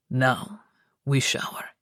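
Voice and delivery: low tender coax; low male voice. Acted. Luca voiced.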